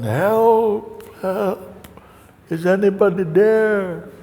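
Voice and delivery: high, hammed-up distress call; mock calling for help. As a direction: call into the dark for rescue. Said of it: "And he calls again".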